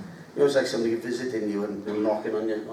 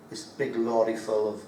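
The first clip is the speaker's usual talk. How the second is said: ordinary talk, nothing marked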